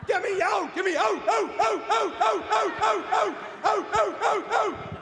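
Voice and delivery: high-pitched frantic dog voice; barking dog. Acted. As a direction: yelp for rescue, turning words into barks. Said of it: "What is your dog trying to communicate".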